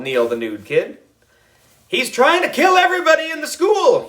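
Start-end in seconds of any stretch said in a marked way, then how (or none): 1.9-4.1 much higher, frantic cry; panicked yell. A narrator's reading. Kid warns everyone the janitor will kill them, panicked yell. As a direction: panic about the chainsaw. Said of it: "yelled Andrew".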